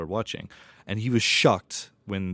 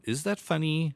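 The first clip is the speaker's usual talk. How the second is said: higher-pitched, wondering question; unsure questioning; 7 semitones higher than usual. Acted. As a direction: ask it as a puzzled viewer weighing it up. Acting out a viewer unsure whether to laugh.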